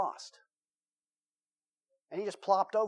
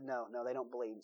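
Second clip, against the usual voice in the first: lower, softer flat insistence; deadpan patient; 7 semitones lower, 10 dB quieter than usual. Retold or imitated. Patient answering the psychiatrist, low and deadpan. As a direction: state it low and certain.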